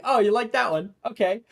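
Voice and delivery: high and loud, delighted; gleeful taunt. Acted. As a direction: revel in the joke landing, pleased and loud. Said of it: "he's like".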